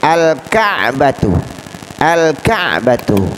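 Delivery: slow "Al-Ka'batu", l distinct, catch after Ka; clearly read al. Shown as a demonstration. Teacher models the example twice, "Al-Ka'batu" with clear l. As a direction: sound the l and stop briefly after Ka in "Al-Ka'batu". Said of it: "dibaca dengan jelas".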